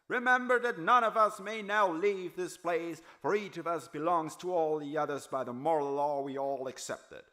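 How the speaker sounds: high, loud yell over a crowd; yelling chairman. Acted. Chairman yelling over a restless mob, high and loud. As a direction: shout it over the noise of the hall. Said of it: "Gerald Starnes yelled through the noise".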